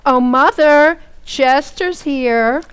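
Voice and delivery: cheerful call, slow. Acted. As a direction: call out to mother down the hall. Calling out to mother's room.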